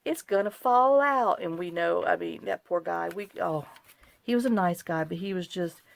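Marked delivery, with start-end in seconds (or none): 0.0-1.4 high, loud, slow catchphrase; imitating grocery packer. Husband's running joke retold, high slow mimicry. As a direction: echo the bagger's worried warning. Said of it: "John still to this day says".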